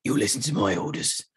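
gruff commanding officer. Retold or imitated. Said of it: "He's proper, like, gruff".

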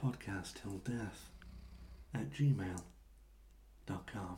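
slow, low, soft seductive voice; sexy narrator voice. Shown as a demonstration. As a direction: purr it out slowly, deep and seductive. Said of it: "I'll do me best naked, uh, narrator voice".

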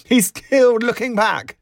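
high, loud, gleeful squeal; laughing. A narrator's reading. Barmaid crowing to her friend about an admirer, high laughing squeal. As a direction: squeal it out as if tickled. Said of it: "she laughed".

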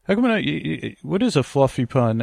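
ordinary talk

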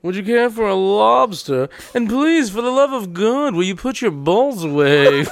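mock-refined, measured politeness; prim plea that slows at the end; fancy club waiter. Waiter begging guest to cover himself, mock-refined politeness.

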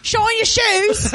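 high, drawn-out Cockney street cry; mock Cockney. Cockney urchin touting for shoe shines, high slow cry. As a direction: call it out slowly like a Cockney shoeshine.

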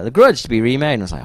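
ordinary delivery

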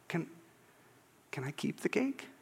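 very soft, hesitant request; acted small, hushed question; timid. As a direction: ask meekly, barely daring to. Overwhelmed Agnes asks hesitantly.

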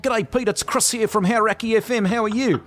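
high-pitched put-on New Zealand accent; prank-call as a chirpy Kiwi radio host; hammed-up, high Kiwi presenter voice; Kiwi radio announcer. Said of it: "this Kiwi radio announcer accent".

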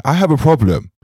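acted caller. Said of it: "if I was going to call you and say".

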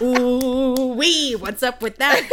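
hammed-up catchphrase. Riffing on the meme with her friend, laughing. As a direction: sing out the meme line with a laugh.

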